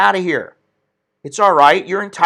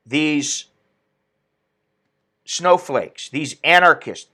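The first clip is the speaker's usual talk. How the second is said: normal talking voice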